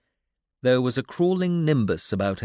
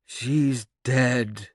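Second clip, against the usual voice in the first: sighed. A narrator's reading. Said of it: "another voice sighed".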